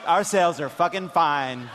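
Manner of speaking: usual speaking voice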